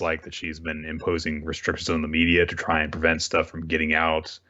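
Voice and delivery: normal talking voice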